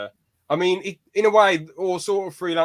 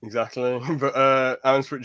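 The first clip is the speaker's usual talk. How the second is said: normal talking voice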